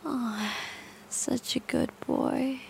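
soft praise. Acted. Praising captive softly.

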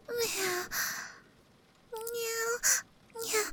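soft, high kitten meows; three small catlike mews; cute meows. Acted. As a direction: mew like a shy kitten. Cat girl meowing.

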